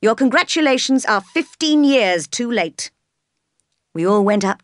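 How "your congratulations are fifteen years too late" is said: high, emphatic outburst; raised, ringing rebuke; bitter cry. A narrator's reading. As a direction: throw their good wishes back at them. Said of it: "cried the master".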